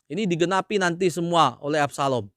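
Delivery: ordinary talk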